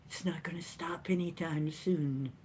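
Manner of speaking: ominous soft whisper. Real, not acted. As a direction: lean in and whisper it.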